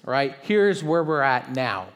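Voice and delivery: usual speaking voice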